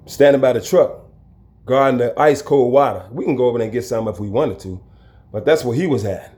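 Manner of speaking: normal talking voice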